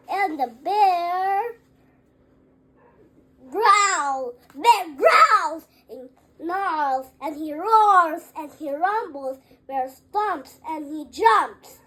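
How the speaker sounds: booming, building loud narration. A narrator's reading. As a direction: boom it out like a waking bear. Angry bear awakes.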